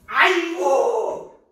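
loud, high drawn-out yelp; mock cry of pain. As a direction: yell it long, playing the victim. Mock cry as technique takes hold.

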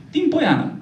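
high, lightly voiced old woman; old village woman. Old woman answers the priest's question, lightly voiced high. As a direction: answer in a thin old granny voice. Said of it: "Să rămână, mămăică".

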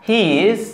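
"he is" run together as "hiiz"; careful correct pronunciation. Shown as a demonstration. Teacher modelling pure pronunciation, "he is" linked as hiiz. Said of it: "যদি আমি বিশুদ্ধ উচ্চারণে".

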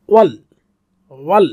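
ordinary delivery

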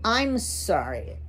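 plain reading voice

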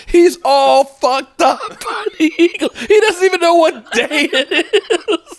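real laughter breaking into the words, high and slow; laughing through words. Real, not acted. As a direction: giggle your way through it. Laughing at the confused fan.

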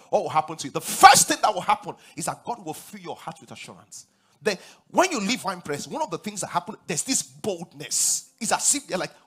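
normal talking voice

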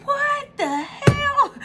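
very high, slow drawn-out disbelief; shocked. Retold or imitated. Watching the BlackRock clip, slow high-pitched shock. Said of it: "and I'm like".

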